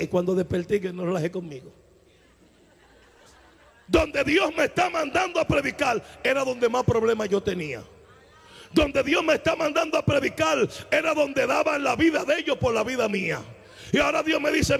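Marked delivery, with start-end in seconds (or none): 3.9-14.8 a pastor thundering his testimony from the pulpit; preaching at full force. Real, not acted. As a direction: proclaim it to the congregation.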